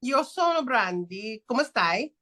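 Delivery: a slow, careful Italian accent. Real, not acted. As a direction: say it like a native Italian. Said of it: "With the accent, y'all".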